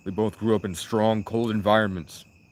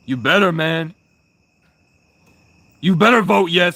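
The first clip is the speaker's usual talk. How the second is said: higher, loud pushy urging; loud demand; 8 semitones higher, 9 dB louder than usual. Real, not acted. Streamer pressuring his chat into a vote, loud demand. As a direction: push the chat hard to vote yes.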